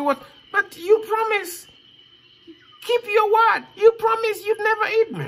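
put-on high, pleading child voice; frightened boy. Boy begs the lion to keep his promise, high and pleading. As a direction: beg in a small high voice.

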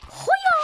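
loud, high-pitched "hu-yo"; playful high whoop. Real, not acted. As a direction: whoop out at the top of the voice. Whoops during play. (chiuit ascuțit și puternic)

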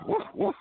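playful woof woof; mock high guard-dog woof; dog bark.

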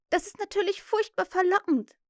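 put-on high, enthusiastic girl; tempted exclamation. A narrator's reading. Wendy gives in to temptation, exclaiming. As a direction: cry out as if won over, high. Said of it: "rief sie".

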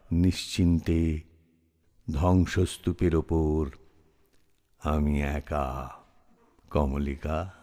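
low, soft voice sinking away; hushed, slowing, with long pauses; desolate. Acted. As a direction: let the verse fade into loneliness. Lonely ending of the poem.

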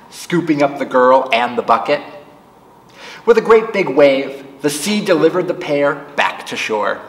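plain reading voice

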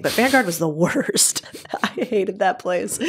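laughing through the words, half whispered. Real, not acted. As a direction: chuckle as you remember the awful job. Laughing recalling hated old job.

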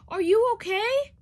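high loud concerned call; worried shout. Acted. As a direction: call out to check on him. After crash, calling to friend.

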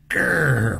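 loud, playful growl; mock growl, loud and rough. A narrator's reading. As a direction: growl back like a little bear. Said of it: "Margaret growled".

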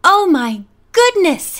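slow, high exclamation of surprise; startled cry. A narrator's reading. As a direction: cry out, taken aback. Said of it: "he cried".

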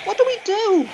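high frightened cry; panicked cry. A narrator's reading. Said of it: "cried Lonnie".

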